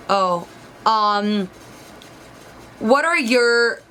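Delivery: slow, drawn-out, halting question; imitated interviewer. Interviewer reacting to her GPA, halting and drawn-out. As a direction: mimic him stretching out each word with long pauses. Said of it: "acting out exactly what he was doing".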